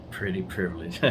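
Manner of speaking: quiet teasing repeat; soft amused echo. Real, not acted. Husband echoing his wife's phrase, softly amused. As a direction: murmur the phrase back with a grin.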